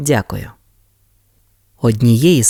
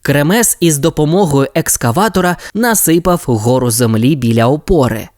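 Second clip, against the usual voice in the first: ordinary delivery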